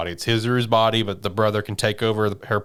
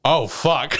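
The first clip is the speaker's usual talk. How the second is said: dread realization; 5 semitones higher than usual. Retold or imitated. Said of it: "He's just like".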